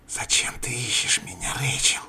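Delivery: creeping nightmare whisper; hissing whisper. Acted. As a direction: hiss it like a demon. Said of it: "прошипела демон".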